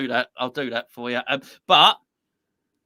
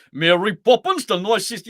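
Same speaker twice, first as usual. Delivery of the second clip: higher, mock Cockney accent; bad Cockney; 4 semitones higher, 6 dB louder than usual. Man mocking an actor's film accent, bad Cockney. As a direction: do a dodgy put-on Cockney. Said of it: "trying to do a Cockney accent".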